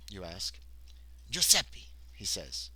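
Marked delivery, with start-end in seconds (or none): 1.3-1.9 put-on slightly higher Italian-accented voice. A narrator's reading. Worker telling the player his name, Italian accent. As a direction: give the name an Italian lilt. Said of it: "he's got an Italian accent".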